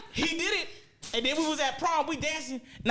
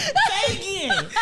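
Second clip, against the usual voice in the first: laughing; 9 semitones higher, 6 dB louder, slower than usual. Retold or imitated. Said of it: "Say, say".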